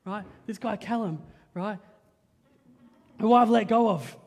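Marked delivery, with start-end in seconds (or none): 3.2-4.2 sarcastic. Acted. Ironic joke about forgiving Callum.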